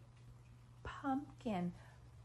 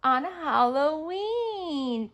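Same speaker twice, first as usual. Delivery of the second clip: slow model word; 2 semitones higher, 6 dB louder, much slower than usual. Shown as a demonstration. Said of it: "Can you say Halloween?".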